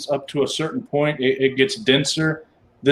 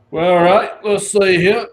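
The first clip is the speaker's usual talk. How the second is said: gate guard voice; 6 semitones higher, 4 dB louder than usual. Acted.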